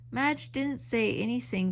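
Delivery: plain reading voice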